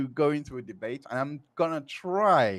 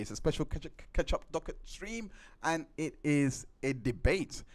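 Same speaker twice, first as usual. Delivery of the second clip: ordinary talk, nothing marked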